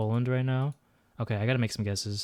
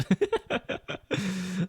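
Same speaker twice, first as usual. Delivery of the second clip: laugh; 8 semitones higher than usual. Real, not acted. Laughing at own accent.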